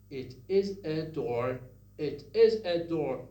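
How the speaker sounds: "It is a door" with glottal stops before each vowel; choppy Farsi-style English with a catch on is and a; imitating a Farsi speaker's English; Farsi-accented English. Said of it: "Farsi speakers sometimes have something like this".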